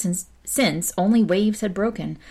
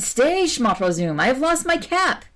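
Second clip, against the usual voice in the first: cry out; 6 semitones higher than usual. Acted. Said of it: "And he cried".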